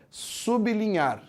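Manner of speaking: "sublinhar" as SUB-linhar, stopping on the B; pronúncia certa. Shown as a demonstration. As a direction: say "sublinhar" slowly, B closing the first syllable: sub-li-nhar. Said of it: "O jeito certo de pronunciar é". ("sublinhar" parando no B: sub(i)-linhar, devagar)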